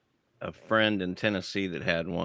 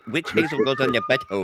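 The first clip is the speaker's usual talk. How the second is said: mocking repeat; faster than usual. Friend parrots the question mockingly.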